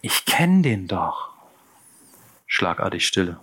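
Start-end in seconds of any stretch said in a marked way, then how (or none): 0.0-1.3 slow, thin little voice; a hint of a thin, unsure voice. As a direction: say it timidly from the back. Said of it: "mit dünner Stimme von hinten".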